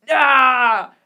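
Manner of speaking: loud acted "ah" of anguish; loud, exasperated yell; frustrated cry. Acts the officer's frustration at forgetting.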